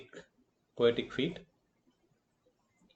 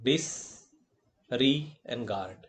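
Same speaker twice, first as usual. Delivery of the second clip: "Dis, re, and guard" slowly, piece by piece; syllable by syllable; slower than usual. Shown as a demonstration. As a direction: break the word up, pausing after "Dis". Teacher splits word into syllables.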